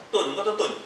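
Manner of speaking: normal talking voice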